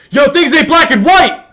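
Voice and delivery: loud, high hyped crowd shout; hype man voice. Retold or imitated. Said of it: "That's your hype man voice?".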